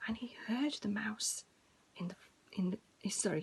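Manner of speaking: usual speaking voice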